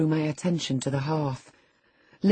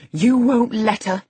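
high, loud, scandalised retort; indignant echo; 6 semitones higher, 7 dB louder than usual. A narrator's reading. Housekeeper throws the boy's refusal back, indignant. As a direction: hurl it back in outrage. Housekeeper echoes him, outraged.

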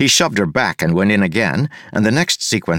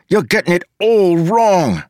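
much higher, louder frustrated complaint; exasperated; 11 semitones higher, 4 dB louder than usual. A narrator's reading. Wayne fed up with the bad copy of his accent, high loud exasperated complaint. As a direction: scold her, fed up.

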